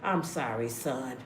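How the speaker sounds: low, hushed fatherly God, sorry for his son; weary God apologizing. Retold or imitated. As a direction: sigh it out like a tired father who made his son suffer. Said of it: "God probably up there ... be like".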